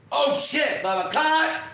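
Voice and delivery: trying too hard, hammed-up yell; overdone shout. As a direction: shout it with way too much effort. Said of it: "I'm gonna make it real".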